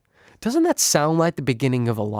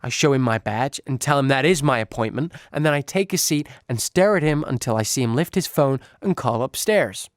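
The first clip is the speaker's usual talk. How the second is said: plain reading voice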